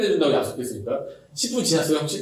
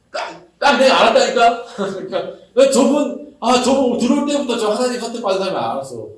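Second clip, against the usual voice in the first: higher, louder, laughing brag; smug know-it-all; 6 semitones higher, 4 dB louder than usual. Retold or imitated. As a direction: claim you knew it all along. 뒤늦게 아는 척하는 흉내. (높고 크게 웃으며 잘난 척)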